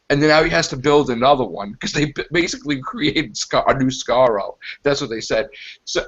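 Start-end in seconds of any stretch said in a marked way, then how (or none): none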